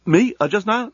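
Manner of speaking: hesitant, caught-out reply; evasive worker. Acted. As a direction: stall with innocent surprise. Shifty worker caught out, stalling.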